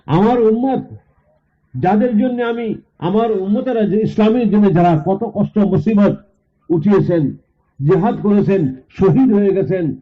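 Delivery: preacher's high declaiming voice; impassioned preaching. Retold or imitated. Preacher voices Prophet's plea.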